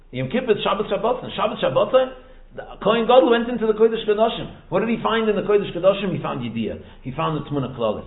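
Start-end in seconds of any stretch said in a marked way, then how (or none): none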